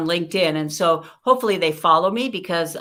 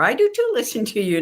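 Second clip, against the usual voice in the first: quick, higher mock protest; argumentative retort; 4 semitones higher, 4 dB louder, faster than usual. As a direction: fire back in a play argument. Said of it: "No argument, right?".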